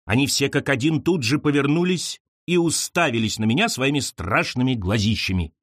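ordinary delivery

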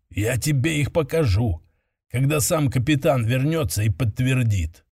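ordinary delivery, nothing marked

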